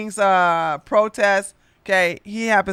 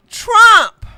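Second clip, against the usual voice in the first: loud announcement; 14 semitones higher, 12 dB louder than usual. Real, not acted. Announcing the topic.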